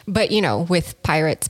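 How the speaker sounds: ordinary delivery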